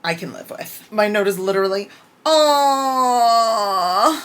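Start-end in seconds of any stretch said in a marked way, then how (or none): 2.2-4.2 a high, loud, teary aww; moved aww. Real, not acted. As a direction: melt into an aww. Her note at a touching moment.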